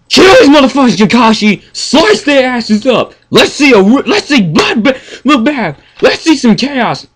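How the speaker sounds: egging him on like a fan in the stands; hyped cheering. Retold or imitated. Fan cheering Kakashi on to slaughter, hyped yelling. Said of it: "I was like".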